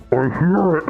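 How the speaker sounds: smothered, far-off voice; muffled voice. Retold or imitated. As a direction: call out as if buried in sand. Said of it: "hear a muffled voice go".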